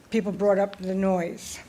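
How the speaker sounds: ordinary talk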